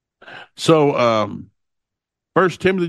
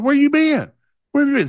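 acted-out parent's high, raised-voice scolding; exasperated demand; 12 semitones higher, 4 dB louder than usual. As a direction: demand an explanation from a late runner. Father confronts daughter at finish line.

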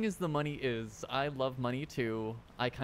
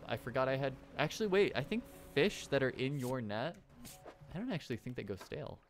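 ordinary talk

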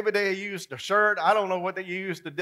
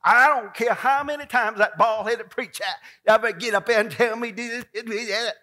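higher, louder defiant rant; mock stubborn outburst; 6 semitones higher, 9 dB louder than usual. Churchgoer defying the preacher, loud mock rant. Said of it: "mocking stubborn churchgoer".

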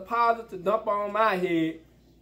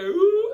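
imitated squeal; 12 semitones higher, 7 dB louder than usual. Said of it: "She said".

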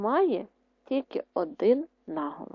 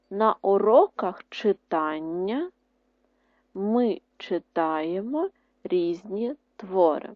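each word spaced out slowly; slow dictation; slower than usual. Shown as a demonstration. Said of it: "Ще раз". (повільний диктант)

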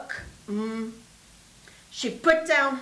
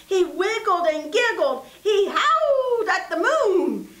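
giddy; 6 semitones higher, 3 dB louder than usual. A narrator's reading. Giddy story lines.

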